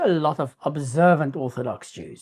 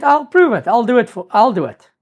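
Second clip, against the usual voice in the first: defiant boast; 6 semitones higher, 6 dB louder than usual. Acted. Defiant woman accepting the challenge.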